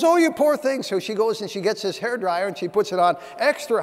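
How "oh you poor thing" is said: put-on high, loud cooing old lady voice; doting old lady. Elderly lady fussing over her dusty parakeet, high cooing. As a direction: coo over the little bird like a fussing granny.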